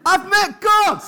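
high, ecstatic shout of a new convert; hammed-up, very high-pitched yell; wild-eyed excitement. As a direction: shout it in their face, bursting with the news. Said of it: "eyes popping out of his head".

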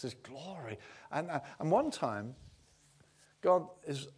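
ordinary delivery

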